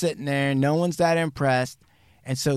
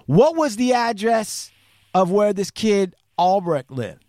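tag; 7 semitones higher, 5 dB louder than usual. Retold or imitated. Urgent question acted out.

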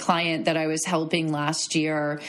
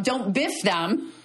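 ordinary delivery

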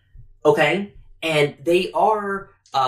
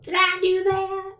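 very high, slow, drawn-out catchphrase; high-pitched goofy impression; Urkel; slower than usual. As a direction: squeal it in a comic sitcom voice. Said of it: "along with, uh, Urkel".

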